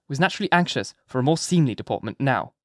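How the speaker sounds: ordinary delivery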